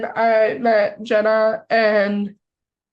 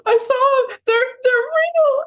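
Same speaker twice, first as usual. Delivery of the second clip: retold crying; 13 semitones higher than usual. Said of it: "I'm just in full-blown tears".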